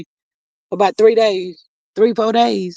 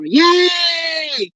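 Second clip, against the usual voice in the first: happy cheer; 6 semitones higher than usual. Real, not acted. Cheering a friend's day off.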